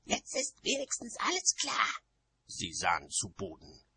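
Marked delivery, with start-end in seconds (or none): 0.0-1.9 high, hissing witch voice; high-pitched angry snarl. A narrator's reading. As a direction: spit it out indignantly at high pitch. Nanny continues after snarling.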